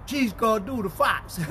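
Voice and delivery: ordinary talk, nothing marked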